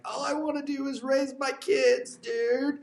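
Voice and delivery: hammed-up teary plea; mock crying plea. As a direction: whimper it like a tired dad. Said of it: "I don't know if they cry like that".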